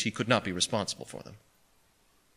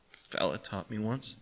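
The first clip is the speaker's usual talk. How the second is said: soft, slow, hushed answer; little boy barely speaking up; small shy voice; 9 dB quieter than usual. A narrator's reading.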